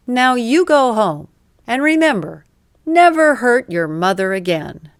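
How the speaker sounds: high, loud pompous scolding; proud lecture. A narrator's reading. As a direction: lord it over the thief with puffed-up pride. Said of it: "puffed up his chest, feeling very proud".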